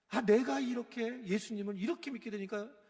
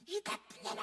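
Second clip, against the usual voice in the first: mock cursing; 11 semitones higher, 5 dB quieter than usual. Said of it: "막 욕을 하는 거예요".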